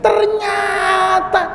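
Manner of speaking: outraged disciple shouting; stretched shout. Acted. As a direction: drag it out to rouse the village. Student exposes teacher drinking.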